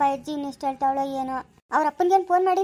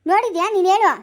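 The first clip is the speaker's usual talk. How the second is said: raised, louder rebuke; indignant telling-off; angry scolding; 4 semitones higher, 5 dB louder than usual. Acted. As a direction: challenge him sharply to silence him. Ordering gossiping man to shut up.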